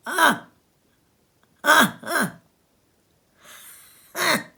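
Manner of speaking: loud, high barking laughter; group of men guffawing; hearty laugh. Acted. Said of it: "received with a general laugh".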